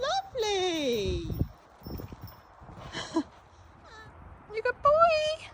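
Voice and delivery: calm the horse with gentle praise; high soothing pet voice. Real, not acted.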